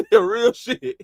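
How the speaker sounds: high-pitched, laughing agreement; amused, voice riding up on a laugh. Real, not acted. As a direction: crack up while saying it. Laughs while reacting to joke.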